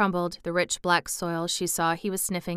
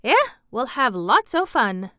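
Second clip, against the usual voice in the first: put-on lively, louder Yorkshire boy; happy grin; 5 semitones higher, 7 dB louder than usual. A narrator's reading. Boy promising Mary lots of fun, happy. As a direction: grin through a cheerful promise. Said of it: "with his happy grin".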